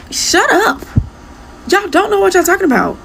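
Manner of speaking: high-pitched exasperated dismissal; exasperated retort. Real, not acted. Creator dismissing commenters defending the idol, exasperated and high-pitched. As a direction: cut them off, voice jumping up high. Snapping back at the commenters' excuse.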